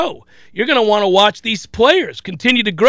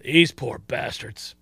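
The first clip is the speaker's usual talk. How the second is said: hushed, feigned commiseration; mock pity; 7 semitones lower, 6 dB quieter than usual. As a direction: sigh it quietly for the doomed opponent. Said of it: "start feeling bad for other teams".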